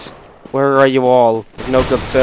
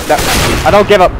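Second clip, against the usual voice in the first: defiant; 4 semitones higher, 3 dB louder, much faster than usual. Real, not acted. Defiantly reverses giving up.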